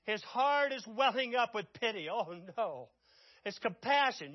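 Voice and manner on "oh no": ordinary delivery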